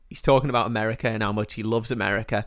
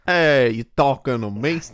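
louder, lightly voiced tough-guy impression; De Niro; 5 dB louder than usual. Copying his co-host's example, a louder amateur tough-guy impression. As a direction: have a go at a movie tough guy, punchy. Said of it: "You do a De Niro and I'll try and copy you".

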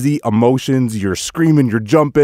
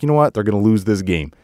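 ordinary talk, nothing marked

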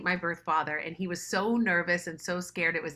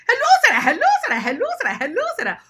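much higher and louder, overlapping hellos; a crowd of happy voices greeting; chorus of relatives; 10 dB louder, much faster than usual. Retold or imitated. Relatives calling Sarah one after another, much higher, louder.

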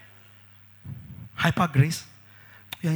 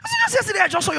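incredulous; 13 semitones higher, much faster than usual. Acted. Incredulous exclaim.

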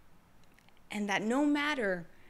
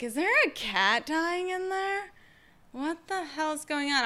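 higher, alarmed neighbor voice, imitating; mock neighbor; 6 semitones higher than usual. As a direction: play the worried neighbor, lightly hammed. Neighbors' voice in funny retelling.

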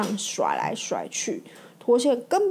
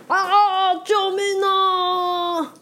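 higher, slow drawn-out wail; panicked scream for help; 9 semitones higher, 4 dB louder, much slower than usual. Acted. Bob flipped over, screaming for help in panic. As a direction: cry out stuck on your side. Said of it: "巴布惨叫着".

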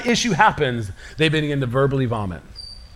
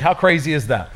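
ordinary talk, nothing marked

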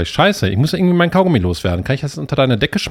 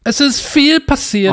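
dramatic high voice; 12 semitones higher, slower than usual. Pretend. Dramatic teaser, high and slow.

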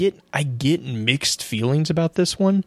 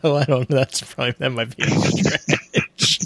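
higher-pitched, laughing through the words; real laughter; 4 semitones higher than usual. Real, not acted. Co-host amused by reasonable worm gods, laughing as talking. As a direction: crack up and struggle to finish. Cracks up at co-host's joke.

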